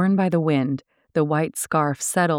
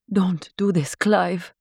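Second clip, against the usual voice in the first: glamorous film heroine, mildly emotional; husky, emotional Laura; 3 semitones higher, slower than usual. A narrator's reading. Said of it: "her accented English husky with emotion".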